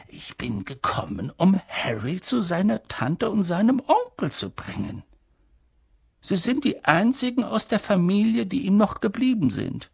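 put-on calm, thoughtful old headmaster; hint of a wise old man musing; lightly voiced old wizard. A narrator's reading. Old headmaster answering the professor calmly.

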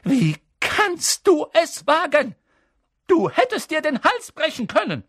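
very high, loud, aghast teacher; furious schoolmistress; angry scolding. A narrator's reading. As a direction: berate the pupil in horrified outrage. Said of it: "ihre Brillengläser funkelten zornig".

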